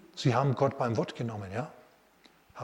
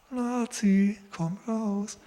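timid mock call; 8 semitones higher, much slower than usual. Wrong way to call Lazarus, timid mock call. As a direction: call him half-heartedly and trail off. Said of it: "Nein, das macht er nicht".

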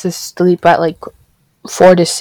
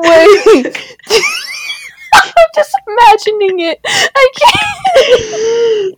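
much higher, shrieking through laughter; can barely speak for laughing; real helpless laughing shriek; crying with laughter; much slower than usual. Real, not acted. Laughing at the wall story.

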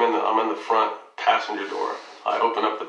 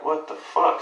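a higher, incredulous mock yell; retold outburst; 7 semitones higher, slower than usual. Father recalling shock as she backs the car away. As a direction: replay the disbelief, voice jumping up.

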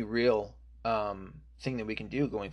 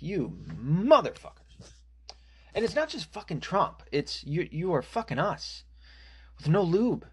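furious rant; 7 semitones higher than usual. Real, not acted.

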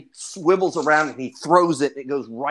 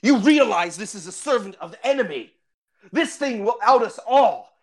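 raised-pitch dramatic indignation; solemn accusation, pitched up and emphatic; stern, accusing ranger; 6 semitones higher than usual. Acted. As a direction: confront them like an outraged fantasy ranger. Game master voices the angry ranger.

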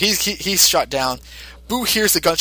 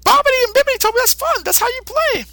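put-on very high childlike monster voice; Boo voice. Boo answering Mister Satan simply, in a very high childlike voice. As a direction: answer like an innocent little creature.

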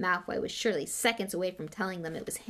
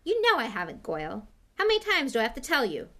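higher, louder irritated retort; snapped; 5 semitones higher, 6 dB louder than usual. A narrator's reading. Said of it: "snapped Malfoy".

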